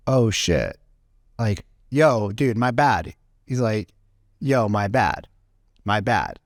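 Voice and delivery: low, sheepish apology; calmed down customer. Retold or imitated. Customer apologizing to the owner, low and sheepish. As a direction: back down and admit it. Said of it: "the guy was like".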